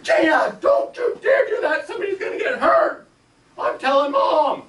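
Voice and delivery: high, loud near-crying shouts; frantic scolding. Retold or imitated. Debbie losing it and shouting at Gina, threatening to tell Mom. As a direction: lose it completely. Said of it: "has lost it by now".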